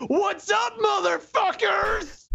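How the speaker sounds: high, far shouted billionaire bellow; smug wealthy tycoon. Acted. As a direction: yell it like a swaggering rich guy. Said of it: "one of my canned billionaire voices".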